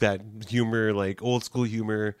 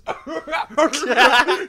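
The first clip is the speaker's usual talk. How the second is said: high laugh; 14 semitones higher, 7 dB louder than usual. Real, not acted. Laughing at the bald guy's joke.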